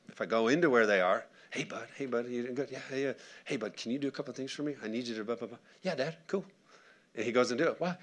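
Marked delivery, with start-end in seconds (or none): 1.5-5.6 quiet, friendly parental request; gentle dad. Acted. Dad talking gently to his son. 5.8-6.4 put-on higher, softer kid's reply; lightly voiced easygoing child; agreeable son. Son's reply voiced by his dad.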